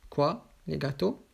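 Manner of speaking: ordinary talk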